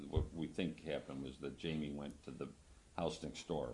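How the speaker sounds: normal talking voice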